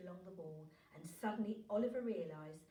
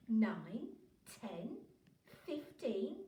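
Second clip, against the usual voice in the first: ordinary delivery, nothing marked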